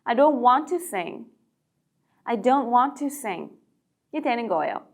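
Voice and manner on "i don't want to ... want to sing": slow, "don't" stressed; slow model sentence. Shown as a demonstration. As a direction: slowly model the negative. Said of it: "노래하기 싫어요". (천천히 "don't" 강조)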